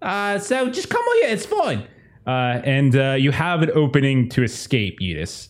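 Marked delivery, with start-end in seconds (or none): 0.0-1.9 high-pitched put-on villager voice; distant villager. Game master plays somebody a block away, high-pitched mock calling. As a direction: call over in a high, silly character voice. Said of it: "you hear somebody else, like, a block away".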